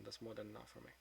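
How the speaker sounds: ordinary talk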